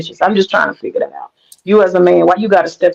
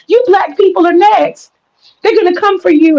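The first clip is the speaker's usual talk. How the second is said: mocking fearmonger; 9 semitones higher than usual. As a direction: ham up her doom warning. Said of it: "I don't wanna hear the fear mongering".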